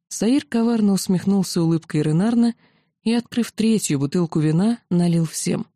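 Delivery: ordinary delivery, nothing marked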